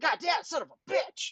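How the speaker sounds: high, held-back cursing in pain; mock pained cursing. Retelling the scratch at work, pained high cursing. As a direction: curse the sting, high and under your voice.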